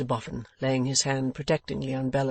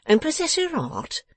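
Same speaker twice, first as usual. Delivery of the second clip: high-pitched mocking echo; sneering, incredulous repeat; ineffable contempt; 5 dB louder than usual. A narrator's reading. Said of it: "retorted Mister Boffin with ineffable contempt".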